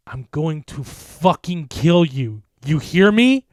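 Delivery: slow, loud threat rising to a yell; furious shout. A narrator's reading. Mark threatens the creature, furious yell. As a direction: roar the threat. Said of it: "I shouted back".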